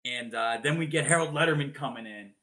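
ordinary talk

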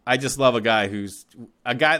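usual speaking voice

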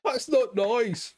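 very high, slow, laughing plea; high frightened voice. Retold or imitated. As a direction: squeak it out, half laughing. High mock-frightened Ross voice.

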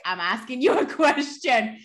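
mock impatient scolding. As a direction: imitate someone fed up with being misunderstood. Retold scolding by impatient questioner.

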